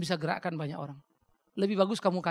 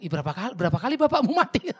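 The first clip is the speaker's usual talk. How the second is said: incredulous retort; 4 semitones higher, 8 dB louder than usual. Retold or imitated. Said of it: "teman saya bilang".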